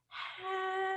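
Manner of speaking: drawn-out, quiet hello; slow hello. Pretend. Puppet Henry greets the kids, slow drawn-out hello. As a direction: stretch the hello out. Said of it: "say hello as slow as we can".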